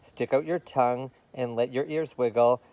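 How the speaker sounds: put-on lively sing-song reading to children; playful challenge verse; taunting rhyme read aloud. A narrator's reading. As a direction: dare the kid in rhythm.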